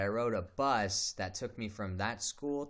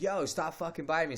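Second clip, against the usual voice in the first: higher, louder retold yell; 8 semitones higher, 4 dB louder than usual. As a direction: yell it like teeth are in your arm. Being bitten mid-fight.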